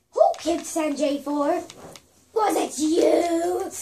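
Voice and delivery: slow loud shout. Real, not acted. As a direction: yell it out slowly. Yelling at a game kicker.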